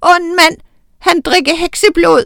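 put-on very high, loud toddler howl; very high loud baby wail; howling baby witch. A narrator's reading. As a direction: wail at the top of her lungs like a small child. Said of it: "Oda hylede i vildens sky".

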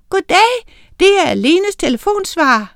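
answering machine. A narrator's reading. Lene's answering machine greeting the caller, recorded voice. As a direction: sound like a canned phone message.

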